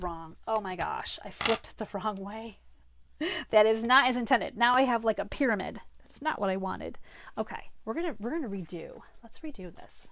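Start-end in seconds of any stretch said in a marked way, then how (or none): 3.5-7.0 loud dismay. Real, not acted. Dismay at a cutting mistake.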